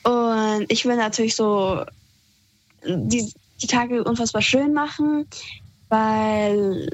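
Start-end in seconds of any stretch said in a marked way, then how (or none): none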